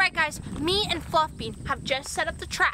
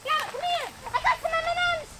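coaxing call; 10 semitones higher than usual. Real, not acted. Coaxing llama down from tree.

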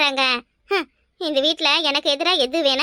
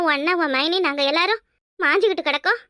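ordinary talk

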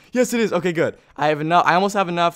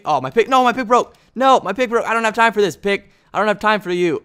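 upset about broken pick; 7 semitones higher, 7 dB louder than usual. Real, not acted. Pickaxe breaks mid-game.

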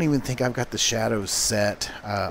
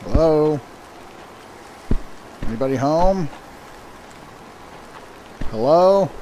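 calling down a hallway; 4 semitones higher than usual. Real, not acted. Calling into an empty building.

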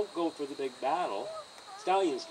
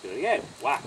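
ordinary delivery

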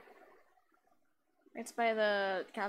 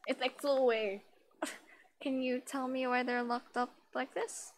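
usual speaking voice

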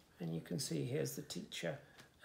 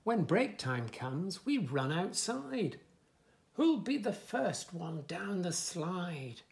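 lively, sing-song story reading; reading to children; 3 semitones higher, 7 dB louder, slower than usual. Acted. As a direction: bounce the rhymes for little listeners. Reads book text.

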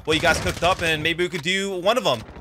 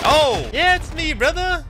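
hyped greeting; 10 semitones higher, slower than usual. Acted. Streamer hypes up his entrance.

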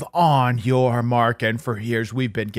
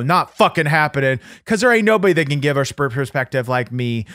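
ordinary delivery

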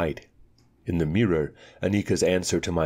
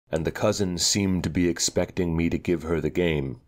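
ordinary delivery

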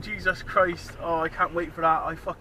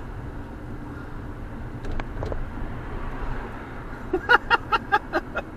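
breathless high laugh; 12 semitones higher, 4 dB quieter than usual. Real, not acted. Laughing at driver.

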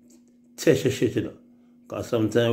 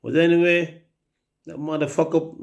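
usual speaking voice

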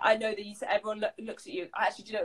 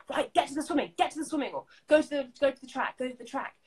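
mock director shout; 8 semitones higher, faster than usual. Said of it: "they'll suddenly shout at you".

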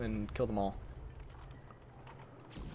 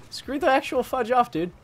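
loud, high, furious outburst; angry yell; 12 semitones higher, 8 dB louder than usual. Real, not acted. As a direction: blow up at the enemy. Raging at enemy player.

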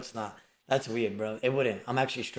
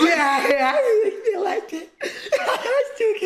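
high squeaky laughing imitation; mock her while laughing; laughing mimicry; 5 dB louder, slower than usual.

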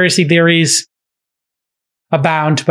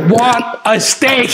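mock scream; 6 semitones higher, slower than usual. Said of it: "He starts screaming".

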